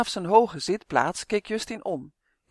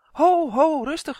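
laughing call; 10 semitones higher, 8 dB louder than usual. Acted. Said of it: "riep Justin lachend".

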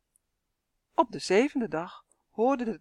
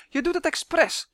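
high, raised-voice accusation; angry shouting; 10 semitones higher, 4 dB louder than usual. A narrator's reading. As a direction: cry out like a furious child, pitched up. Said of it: "schreeuwde hij". (boos schreeuwende jongen)

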